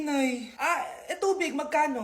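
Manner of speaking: normal talking voice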